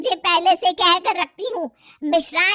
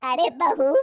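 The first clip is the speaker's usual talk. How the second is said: ordinary talk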